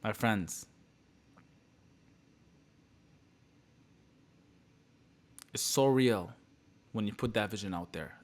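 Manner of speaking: hushed, sincerely moved by the memory; emotional. Real, not acted. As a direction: let the feeling quiet the voice. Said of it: "I'm getting emotional talking about this".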